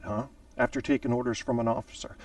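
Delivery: normal talking voice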